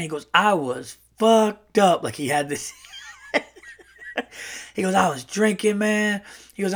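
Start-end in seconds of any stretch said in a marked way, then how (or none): 0.3-2.0 slow, higher brash uncle; slow, emphatic brash storyteller; Earl. Retold or imitated. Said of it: "like, a very brash uncle". 4.9-6.2 higher-pitched brash uncle storyteller; lightly voiced brash uncle; Earl. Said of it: "like, a very brash uncle".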